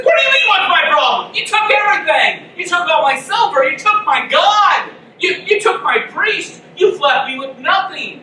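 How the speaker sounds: hammed-up shouting outrage; outraged Micah. Micah ranting at the men who robbed him, hammed-up fury. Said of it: "He said".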